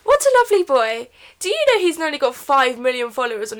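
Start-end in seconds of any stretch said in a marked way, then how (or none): 0.0-1.8 higher, louder doting gush; gushing mock adoration. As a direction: coo over him like a besotted fan.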